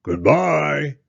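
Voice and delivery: loud, big bear farewell; big gruff voice. Acted. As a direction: call it out as Papa Bear. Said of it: "a big gruff voice".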